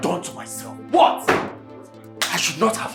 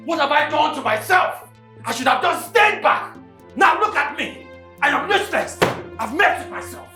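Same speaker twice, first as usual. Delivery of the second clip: panicked; 7 semitones higher than usual. Acted. Clinton panics over what he did.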